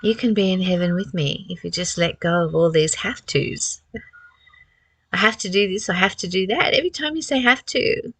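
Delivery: normal talking voice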